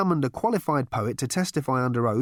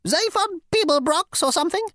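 high, huffy bug voice; flouncing insect; 4 dB louder than usual. Acted. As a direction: toss it off with sass. Insect sneers.

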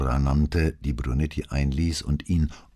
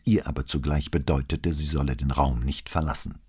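ordinary delivery

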